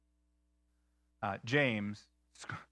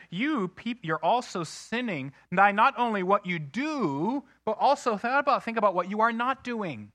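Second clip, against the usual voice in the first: higher, louder preaching of James; paraphrased exhortation; 7 semitones higher, 5 dB louder than usual. Retold or imitated. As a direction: press the rebuke on the listeners. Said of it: "he says".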